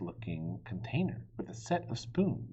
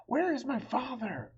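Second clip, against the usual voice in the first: frightened cry; 14 semitones higher than usual. Acted. Frightened boy after scream.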